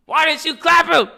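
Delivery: high, loud mock-angry brother voice; mad brother. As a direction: play the jealous brother whining high and loud. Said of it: "I said".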